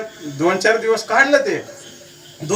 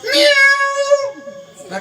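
long, very high meow; cat meow; much slower than usual. Acted. Cat disturbing the chanting holy man, long high meow. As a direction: stretch out a high cat cry. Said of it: "मांजर आरडायला लागली मोठमोठ्यानी".

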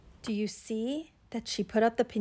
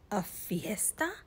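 soft, slow question; slow, quiet turtle voice; 3 semitones lower, 8 dB quieter than usual. Acted. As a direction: ask it softly, taking your time. Tortuga pokes out of shell.

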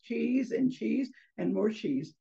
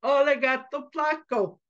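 put-on higher, sing-out little boy taunt; teasing boy; 5 semitones higher, 4 dB louder than usual. Boy mouse teasing the cat through the fence, teasing. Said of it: "boys love to tease".

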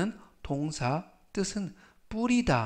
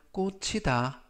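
normal talking voice

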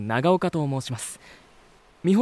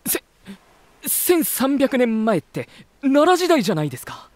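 high and loud in disbelief; stunned outburst, stretched then rushed; shocked exclamation; 12 semitones higher, 5 dB louder than usual. Real, not acted. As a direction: cry out in astonishment. Shocked by 1300 years.

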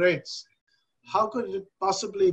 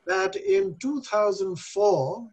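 normal talking voice

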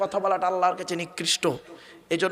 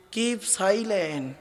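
slow reading; much slower than usual. Retold or imitated. Reading office sign aloud.

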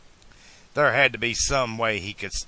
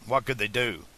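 plain reading voice

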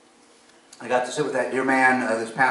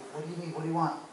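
higher-pitched, retold puzzled questions; puzzled questioning; 6 semitones higher, 6 dB quieter, much faster than usual. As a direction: ask helplessly what he wants. Puzzled, asking a mute man.

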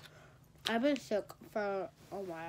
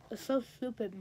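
ordinary talk

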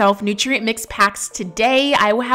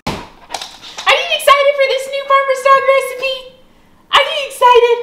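excited dog talk; 5 dB louder than usual. Real, not acted. Owner hypes her dogs before dinner, high squeaky baby talk. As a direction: gush at the dogs like they're toddlers.

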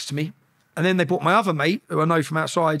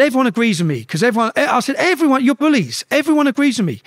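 raised, high defiant retelling; giving it out; 7 semitones higher, 4 dB louder than usual. Retold or imitated. Prisoner arguing with everyone through the cell windows, giving it out. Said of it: "I start giving it through the windows".

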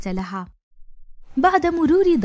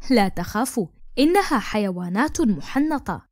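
usual speaking voice

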